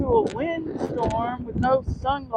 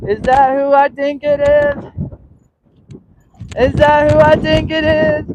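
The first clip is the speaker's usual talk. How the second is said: high-pitched playful question to a pet; excited dog talk; 6 semitones higher than usual. Pretend.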